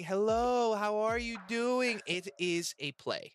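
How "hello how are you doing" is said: higher, louder, slow sugary customer-service greeting; fake server voice. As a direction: put on the overly sweet waiter voice for the guests. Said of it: "it's the voice and everything".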